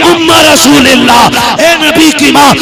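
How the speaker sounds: high, loud rapturous sermon storytelling; full-force preaching. Acted. Preacher telling the crowd of Amina at the well, impassioned full-force delivery. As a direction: build to a loud, high-pitched peak and hold it there.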